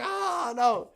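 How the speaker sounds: high, loud mock wail; mock terrified cry. Host imitating scared woman at grocery store, loud mock wail. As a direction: wail it like she's panicking. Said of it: "She's like".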